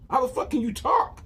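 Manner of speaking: high, loud, stunned yell at a talking pony; shocked shout. Retold or imitated. Shouting at the pony that just spoke, stunned. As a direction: shriek it out in disbelief. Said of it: "I was like".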